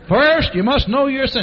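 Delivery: high, loud proclamation; loud public reading. Retold or imitated. Carl reads tract to people at gate, loudly proclaiming. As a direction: read it out for all comers.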